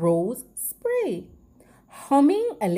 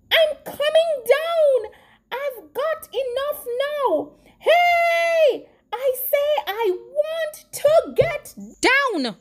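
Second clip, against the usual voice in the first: yelling; 13 semitones higher, 3 dB louder than usual. A narrator's reading. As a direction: call out high and insistently to be heard. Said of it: "The voice yelled again".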